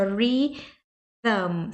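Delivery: "rhythm" very slowly, split as "ree... thum"; "rhythm" drawn out, tongue between teeth on TH; TH plus M. Shown as a demonstration. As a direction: model "rhythm" in two separated syllables, voiced TH. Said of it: "por causa desse T H aí junto com M".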